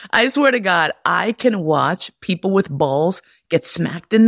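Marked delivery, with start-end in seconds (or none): none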